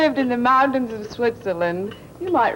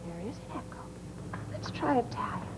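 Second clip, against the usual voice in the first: whispered, unvoiced aside; 2 semitones higher, 15 dB quieter, faster than usual. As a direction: breathe it softly. Whispered lead-in to echo.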